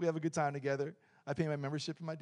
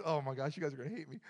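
ordinary talk

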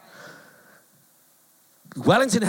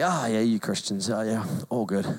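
a hint of a lower, softer shrug; dismissive; 9 semitones lower, 6 dB quieter than usual. Neighbours waving off Christians, mildly dismissive. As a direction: brush it off lightly.

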